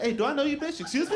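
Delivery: high, indignant mock offense; mock offended. Comic answers nurse calling him daddy, high mock offense. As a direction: act shocked at being called daddy. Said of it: "I was like".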